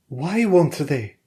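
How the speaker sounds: a wary wife pressing her husband; Valeria's voice. A narrator's reading.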